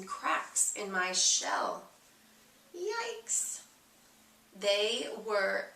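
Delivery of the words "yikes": high, thin gasp of alarm; startled yikes. A narrator's reading. Egg spotting cracks in his shell, startled yelp.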